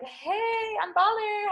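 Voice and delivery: cocky squeaky boast; bragging baller. Pretend. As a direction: strut it like a baller. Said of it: "you're like".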